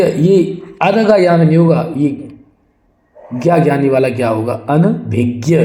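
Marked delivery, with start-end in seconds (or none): none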